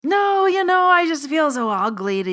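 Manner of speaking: mock herself as awkward and coy; flustered refusal. [name] refusing the client's money, high flustered protest. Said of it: "And I was like".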